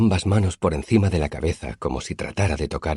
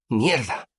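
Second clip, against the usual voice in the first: higher startled exclamation; sudden curse; 9 semitones higher than usual. A narrator's reading. Simon spilling drink at the tavern table, startled higher curse. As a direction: blurt it as the glass tips.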